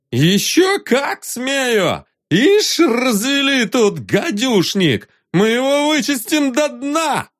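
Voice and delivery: put-on high slurring drunk rant; drunken falsetto. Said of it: "пьяным фальцетом".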